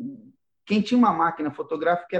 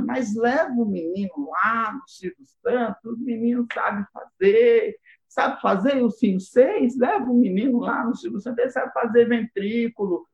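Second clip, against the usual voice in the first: bossy godmother; 7 semitones higher than usual. Retold or imitated. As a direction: push it like a pushy godmother. Godmother urging mother to go.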